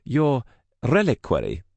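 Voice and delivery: put-on hesitant Russian-accented reach for the word; searching for the word. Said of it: "struggled to find the right word".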